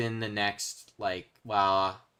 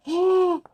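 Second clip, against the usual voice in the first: sharp high gasp of delight; excited gasp; 11 dB louder than usual. Real, not acted. Streamer finding ore gasps in delight. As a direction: gasp at the lucky find. Finds rare ore while mining.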